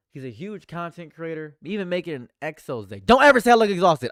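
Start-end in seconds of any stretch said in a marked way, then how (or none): 3.0-4.1 explosive angry yell; furious shout. Real, not acted. Streamer snapping at a chat comment, furious shout. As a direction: blow up at the chat, full volume. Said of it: "I'm crashing out".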